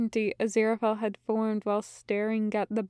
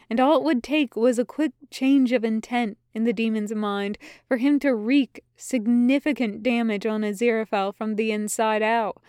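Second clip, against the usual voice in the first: usual speaking voice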